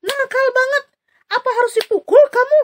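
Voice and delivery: angry shout. Acted. Bileam yells at the donkey, loud and fast. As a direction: yell at the naughty donkey. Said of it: "Bileam marah-marah ya mukul".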